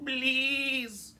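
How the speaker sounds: high, pleading little goat voice; scared little goat. Acted. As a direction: beg nervously like a tiny goat. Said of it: "said the hoof-shaking tiny".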